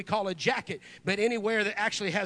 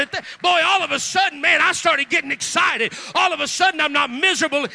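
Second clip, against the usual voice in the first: rising, louder sermon cadence, driving each line home; full-force preaching; 6 semitones higher, 8 dB louder than usual. Acted. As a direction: preach it out to the back row.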